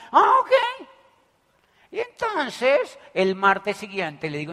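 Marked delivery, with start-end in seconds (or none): 0.1-0.5 high, loud startled ah-ok; taken aback, voice jumping up; caught off guard. Retold or imitated. The man answering the speaker, startled and high. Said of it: "Y dice el tipo".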